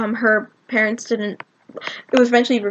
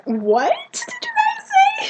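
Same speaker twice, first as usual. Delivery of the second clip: very high, slow, stretched question; baffled exclamation; much slower than usual. Retold or imitated. Colleen didn't catch the fans' chant, high baffled exclamation. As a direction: imitate someone squeaking in confusion.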